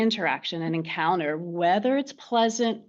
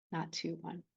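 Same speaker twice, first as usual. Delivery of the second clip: soft hushed echo; 14 dB quieter than usual. Shown as a demonstration. Teacher softly echoing her words to the class, hushed. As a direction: let "Not two one" fall to a near-hush, much softer.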